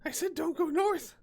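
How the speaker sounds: very high-pitched goblin protest; scolding goblin. Acted. Goblin telling captors they went the wrong way, high scolding.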